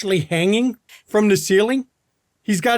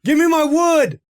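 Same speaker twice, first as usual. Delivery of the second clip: high, loud shout to someone above; yelling up; 8 semitones higher, 3 dB louder than usual. Acted. Johnny yells up for his golf club, shouting. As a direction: yell up out of the hole. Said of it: "he yells up".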